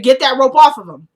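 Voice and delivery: Lucian's fury, shouted in full; angry shout. Retold or imitated. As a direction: bark the order at the crowd. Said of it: "I appreciated the anger in Lucian's voice".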